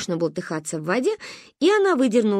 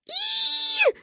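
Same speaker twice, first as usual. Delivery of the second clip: very high gleeful squeal. A narrator's reading. Girl thrown into the sea, squealing high. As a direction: squeal as you fly into the sea. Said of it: "завизжала Манька".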